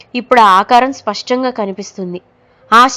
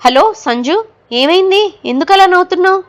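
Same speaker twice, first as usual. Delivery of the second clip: worried calls into the phone, pitched much higher; urgent, rising alarm as he asks; anxious; 9 semitones higher than usual. A narrator's reading. Husband on the phone to his laughing wife, anxious and high. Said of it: "అడుగుతున్నాడు ఆతృతగా".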